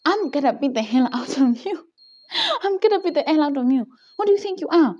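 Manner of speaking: high-pitched threatening outburst; retold furious shouting. Intern getting in her boss's face, retold angry shouting. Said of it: "I start shouting at him".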